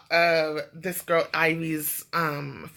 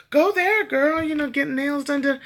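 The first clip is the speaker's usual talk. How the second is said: cheerful urging; 8 semitones higher than usual. Retold or imitated. Friend cheering her on.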